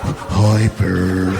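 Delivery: slow and low like a spaced-out elephant; junkie elephant. Acted. Junkie elephant greeting Big Bird, low slow voice.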